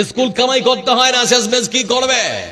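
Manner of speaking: loud, high-pitched scolding mother; retold scolding. Mother scolds child for wanting to skip school, loud retold reprimand. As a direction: raise the voice sharply, imitating a scolding parent. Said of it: "তার মা ধমক দিয়ে বলছে".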